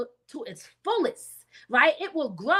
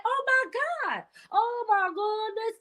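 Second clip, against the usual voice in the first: high-pitched, drawn-out put-on exclamation; high comic character voice; Shanae; 10 semitones higher, slower than usual. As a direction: exclaim it way up high, stretching the words. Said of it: "In my Shanae voice".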